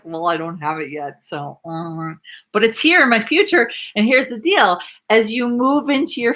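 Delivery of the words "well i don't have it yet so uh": soft, resigned, trailing off; deflated doubt. Retold or imitated. Coach imitating a student who doubts the dream, deflated and soft. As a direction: let the hope drain out quietly. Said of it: "You're like".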